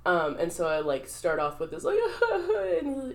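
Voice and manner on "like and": high, drawn-out fake wail; hammed-up improv sob mixed with laughter; mock sobbing. Student starting an improv scene, mock sobbing. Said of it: "uncontrollably sobbing".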